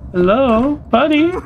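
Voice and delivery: high coaxing voice. Real, not acted. Player gently coaxing a scared hiding creature, high coaxing voice. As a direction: coax the frightened little thing out of hiding.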